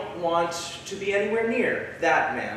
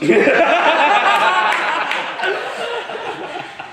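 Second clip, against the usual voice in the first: high-pitched loud giggle fit; high loud laugh; 13 semitones higher, 7 dB louder than usual. Real, not acted. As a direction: squeal with laughter. Laughs about Will.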